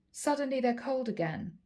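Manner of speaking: normal talking voice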